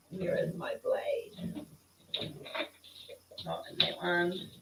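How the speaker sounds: hushed, unhurried aside; slow whisper. Real, not acted. Crafter showing viewers her blade, slow whisper. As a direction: whisper it slowly, close to the mic.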